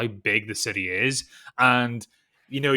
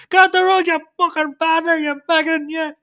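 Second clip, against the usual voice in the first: mock furious shout, much higher and louder, Irish; irate tram driver; 8 dB louder than usual. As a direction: bellow at the blocking car like a raging Dublin driver.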